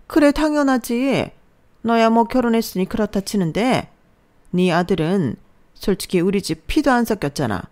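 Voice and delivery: drip contempt, drawing out the first words; scornful mother-in-law. Acted. Mother-in-law mocks the stepson.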